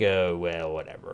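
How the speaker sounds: low, soft shrug; mock indifferent woman. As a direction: imitate her brushing it off. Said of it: "and she's like".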